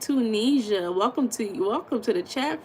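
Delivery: usual speaking voice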